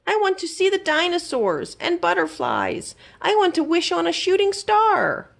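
high pleading mouse voice; longing little mouse. A narrator's reading. Mouse left out wishing to go, high pleading. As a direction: wish it aloud in a tiny high voice. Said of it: "Fergus thought".

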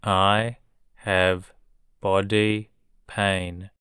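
I have, body pain slowly, in two chunks; slow it down for learners; slow model. Shown as a demonstration. Teacher modelling a sentence for learners, slowly. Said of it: "teacher drilling vocabulary".

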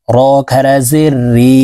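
রি stretched long, pulled downward; slow drill recitation. Shown as a demonstration. Teacher showing the long khara zer, slowly. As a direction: draw out the ee a full alif. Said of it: "খারা জেরের উচ্চারণ ... এক আলিফ টেনে".

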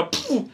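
soft pff. Retold or imitated. Imitates injection puff.

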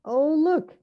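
high, loud exclamation; excited discovery. Pretend. Storyteller shows listeners the next picture, excited high exclamation. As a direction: point it out with high delight.

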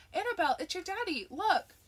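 put-on high, loud, thrilled call to the child; excitedly calling the little girl to the door. A narrator's reading. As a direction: announce daddy's arrival with joy. Sherry sees Charlie arrive home.

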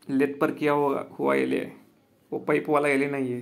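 ordinary talk, nothing marked

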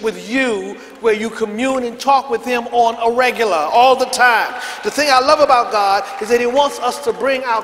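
loud, driving sermon voice; emphatic preaching. Real, not acted. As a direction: press each point home forcefully, drawing out key words. Preaches at raised force.

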